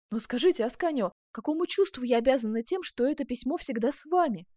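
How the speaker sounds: loud, high, hopeful question; eager questioning. A narrator's reading. Duchess hoping Ascanio loves her, eager. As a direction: press him eagerly for his feeling.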